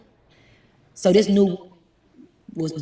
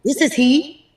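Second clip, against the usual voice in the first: higher and louder, rising at the end; retold proud answer; 8 semitones higher, 8 dB louder, slower than usual. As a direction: announce yourself brightly. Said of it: "And he said".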